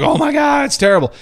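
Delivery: high, mock-horrified gasp; mock shocked fans. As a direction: squeal it like a shocked fan. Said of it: "everyone was like".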